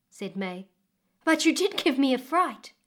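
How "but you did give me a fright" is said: higher and louder, a touch breathless from the fright; a mild startled exclaim; startled girl. Acted. May startled by the old woman, higher and louder.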